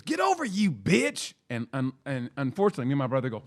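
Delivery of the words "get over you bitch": high, slow snarl of a rude driver; mock angry driver. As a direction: sneer it like a road-rage lady. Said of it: "she goes".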